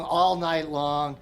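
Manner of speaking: ordinary delivery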